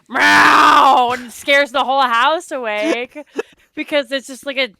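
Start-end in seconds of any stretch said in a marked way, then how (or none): 0.1-1.1 imitating a screeching cat, very loud. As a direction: let out one long demon-cat yowl. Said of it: "he's very loud".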